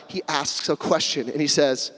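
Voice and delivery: ordinary talk, nothing marked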